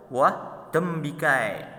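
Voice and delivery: ordinary delivery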